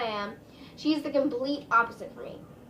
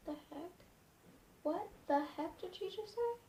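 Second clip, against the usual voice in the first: softer and higher, incredulous; stunned disbelief; 6 semitones higher, 10 dB quieter than usual. Retold or imitated. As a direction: murmur it in shocked disbelief. Stunned reaction to waitress.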